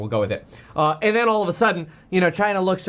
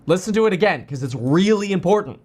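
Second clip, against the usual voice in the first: ordinary delivery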